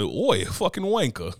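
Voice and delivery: slow, hammed-up British accent; mock British insult. Mocking the actor's British side, slow British accent. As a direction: drag out the British slang in a lightly put-on accent. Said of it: "old boy was a Brit".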